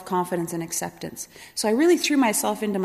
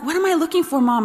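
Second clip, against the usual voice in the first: put-on excited child; 7 semitones higher, 5 dB louder than usual. Excited child questioning mom.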